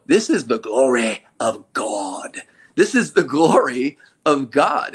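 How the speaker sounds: slow, drawn-out preacher voice rising on "This is the glory of God"; stretched-out, booming preacher cadence on "glory"; preacher sound. Shown as a demonstration. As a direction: preach it like a pulpit with the organ behind you. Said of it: "say preacher words with preacher sound".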